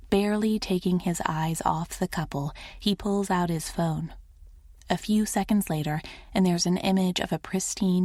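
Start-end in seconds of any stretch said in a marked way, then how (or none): none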